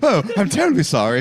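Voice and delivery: mock British accent. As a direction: apologise in a hammed-up British accent. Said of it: "a Hugh Grant movie".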